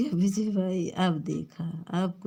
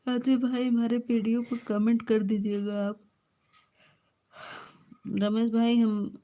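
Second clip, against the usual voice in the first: excited calling out; 4 semitones higher than usual. Real, not acted. Streamer shouting out to viewers Ajay and Ramesh, raised and eager.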